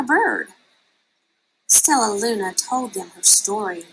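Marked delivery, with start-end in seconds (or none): none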